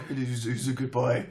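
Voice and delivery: put-on doting doggy baby talk. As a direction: coo at the dog like a baby. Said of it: "I was just baby talking to the dog".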